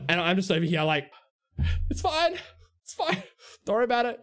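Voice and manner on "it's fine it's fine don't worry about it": high-pitched, uneasy brush-off; nervous reassurance. Retold or imitated. Clerk backing off as a big guy walks up, nervous. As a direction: fend him off politely in a high voice.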